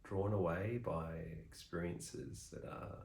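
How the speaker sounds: soft, unhurried meditation guide with long pauses; softly spoken meditation instructions, spaced well apart; guided meditation. Real, not acted. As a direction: gently talk them through the practice, leaving long silences.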